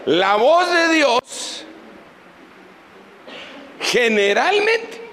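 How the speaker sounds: a slow, mysterious reveal. Acted. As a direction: unveil it word by word, slowly. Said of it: "le voy a decir otro secreto".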